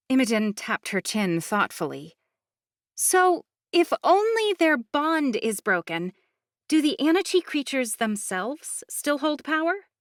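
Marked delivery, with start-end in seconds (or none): 2.9-9.9 high, eager questioning voice; curious young woman. Acted. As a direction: ask it high and keen. Imogen thoughtful question.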